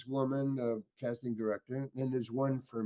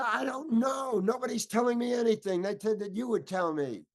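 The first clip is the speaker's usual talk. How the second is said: high-pitched indignant protest; blown top; 9 semitones higher than usual. Retold or imitated. Actor telling director nobody told him anything, blowing his top. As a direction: retell an exasperated outburst, pitched way up. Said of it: "so then the top blew".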